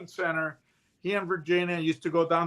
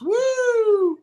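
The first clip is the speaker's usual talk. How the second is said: high loud siren whoop, imitated; 6 dB louder than usual. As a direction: yelp it out like a police siren.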